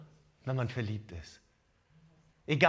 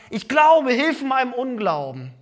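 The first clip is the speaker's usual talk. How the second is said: pleading; 6 semitones higher, 7 dB louder than usual. Acted. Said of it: "Und er sagt".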